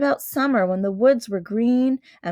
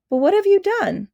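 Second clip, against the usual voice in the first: put-on much higher, startled little girl; girl's sudden frightened question; alarmed Lucy; 10 semitones higher, 4 dB louder than usual. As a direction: blurt it out in alarm.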